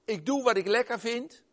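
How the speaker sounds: loud, high, smug voice of a self-centred person; mock selfish boast. Preacher to congregation, mocking the selfish mindset, loud and smug. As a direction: parody the me-first attitude, loud and high.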